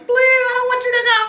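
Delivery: high, fast, frantic begging; hysterical pleading. Retold or imitated. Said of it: "I was hysterical".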